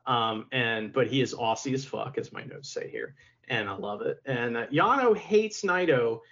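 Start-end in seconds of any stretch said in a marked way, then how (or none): 2.2-3.1 breathy unvoiced whisper; whispered aside. Real, not acted. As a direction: drop to a secret whisper. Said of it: "aside about notes".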